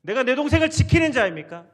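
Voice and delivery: very loud, high-pitched challenge; acted-out indignant protest; defiant retort. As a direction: throw the question back at God. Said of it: "대적하는 거예요".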